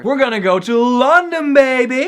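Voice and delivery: loud high hype; real hype shout; excited shout. Real, not acted. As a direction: yell it like a celebration.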